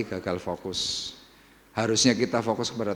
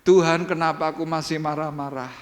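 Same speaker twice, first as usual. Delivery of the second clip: model complaint prayer; 6 semitones higher, 4 dB louder than usual. Shown as a demonstration. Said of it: "Coba kamu ngeluh itu aja".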